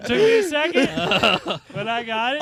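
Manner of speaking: laughing as he speaks. Real, not acted. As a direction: talk through the laughter. Laughing after the punchline.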